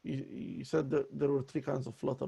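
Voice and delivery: normal talking voice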